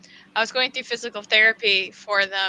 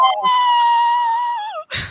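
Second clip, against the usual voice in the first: very high, drawn-out wail; hammed-up high agonized cry; retold scream of pain; 3 dB louder than usual. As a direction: act out crying out as a knee cracks. Retold pain as the knee cracks.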